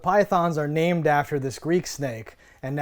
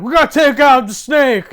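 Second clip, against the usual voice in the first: loud, high gung-ho action hero bark; 8 semitones higher, 9 dB louder than usual. Retold or imitated. Podcaster mimicking Casper Van Dien's action hero, loud and high. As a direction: play the square-jawed soldier charging in. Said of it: "Starship Troopers Casper Van Dien is gonna go in there and be like".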